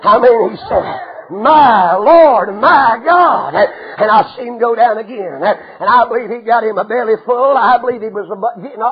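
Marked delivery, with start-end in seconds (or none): 1.3-3.5 loud awestruck cry; amazed exclamation. Acted. Moses tasting sweet water, loud amazed outburst. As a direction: shout it in wonder.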